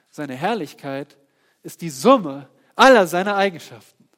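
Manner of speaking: loud proclamation. Acted. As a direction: boom it out to the congregation. Loud preaching take.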